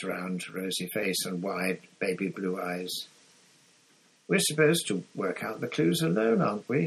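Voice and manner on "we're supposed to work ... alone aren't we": higher-pitched, studiedly casual query; light, careful not to accuse; carefully casual. A narrator's reading. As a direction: ask it as if it hardly matters. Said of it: "careful to keep his voice casual".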